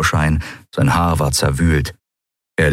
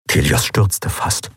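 ordinary delivery

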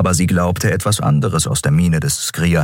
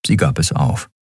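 plain reading voice

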